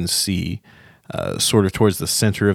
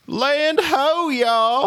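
hearty Land ho; much slower than usual. Retold or imitated. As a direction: belt it out like a cheerful sailor. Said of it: "[name] giving a hearty".

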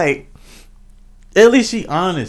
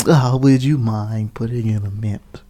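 mockingly courteous, lower voice; mock polite request; 4 semitones lower than usual. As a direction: put on an over-polite ask. Mock-polite alternative to blunt honesty.

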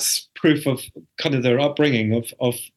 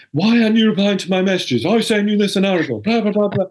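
mock rant; 7 semitones higher, 4 dB louder than usual. Husband's impatient rant retold.

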